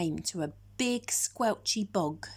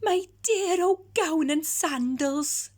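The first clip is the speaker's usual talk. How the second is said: overjoyed; 7 semitones higher, 5 dB louder, slower than usual. Acted. Giant overjoyed at finding gown.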